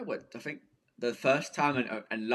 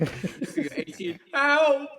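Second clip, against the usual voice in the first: higher, louder hammed-up cry for help; teasing mock scream for help, much higher; mock yell for help; 5 dB louder, much slower than usual. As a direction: shout help like a scared kid, teasing.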